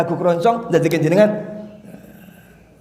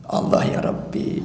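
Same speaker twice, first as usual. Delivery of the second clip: whispered sigh; 8 dB quieter than usual. Acted. Sighing exclamation after the count.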